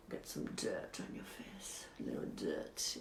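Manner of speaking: hushed, breathy aside to camera; whisper. Acted. Beauty host whispering to viewers while applying dirt. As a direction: whisper it like a secret tip.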